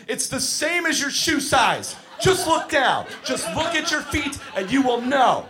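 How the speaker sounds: shouting at campers who can't find skate sizes; exasperated yelling. Retold or imitated. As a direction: yell it like you're losing it. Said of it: "a grown man, losing his shit".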